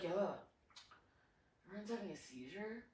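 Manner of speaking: very soft, low, offhand delivery; nonchalant. Retold or imitated. Camper casually reporting a friend's seizure, nonchalant. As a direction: mumble it quietly as if it's nothing. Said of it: "Like, just so nonchalant".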